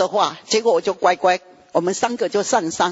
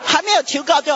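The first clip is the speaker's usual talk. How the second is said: normal talking voice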